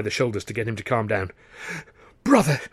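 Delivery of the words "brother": higher, alarmed wheeze; wheezed. Acted. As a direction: force the word out breathlessly. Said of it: "Luscombe wheezed".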